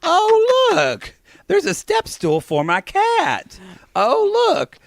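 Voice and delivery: high, hammed-up "oh look" discoveries; mock delight. Acting out him flipping through the magazine, high mock delight. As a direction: squeal each find like a delighted browser. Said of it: "flipping through the magazine".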